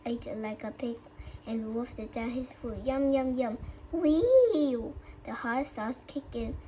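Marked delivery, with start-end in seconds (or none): 2.8-4.9 gobble it up and squeal with delight; greedy yum. A narrator's reading. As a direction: coyote gulps the chili and whoops. Greedy coyote gobbling chili.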